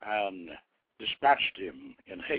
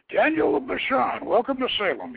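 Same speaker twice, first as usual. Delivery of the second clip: much higher, louder jovial greeting; hearty royal welcome; 12 semitones higher, 5 dB louder than usual. Acted. The holy king welcoming Daniel, friendly and booming. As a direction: greet him like a beaming giant.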